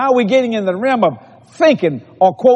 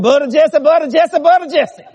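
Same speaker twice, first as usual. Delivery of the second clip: higher-pitched mock old lady, chanting in threes; old lady speaking in threes; 5 semitones higher than usual. Preacher mimicking a ninety-three-year-old church lady, higher repetitive voice. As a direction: imitate her insistent triple repeats. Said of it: "That's how she talked".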